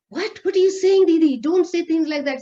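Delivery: imitating a distressed sister, very high and loud; high, loud protest on the verge of tears; starting to cry. Younger sister hears father will die, tearfully alarmed. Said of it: "She started crying".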